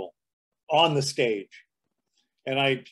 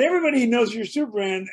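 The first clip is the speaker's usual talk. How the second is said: higher, quick insisting host; eager insisting; 8 semitones higher, faster than usual. Retold or imitated. Host insisting to Clark Kent, higher and quick. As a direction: rattle it off, pushing him. Host repeating coaxing line.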